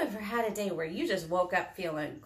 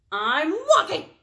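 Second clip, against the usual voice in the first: loud, high, irritated snap; exasperated storybook bird; snapping; 7 semitones higher, 10 dB louder than usual. A narrator's reading. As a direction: snap it back at a nosy friend. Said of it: "snapped Bird".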